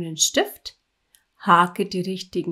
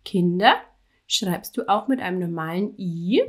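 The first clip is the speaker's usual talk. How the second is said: normal talking voice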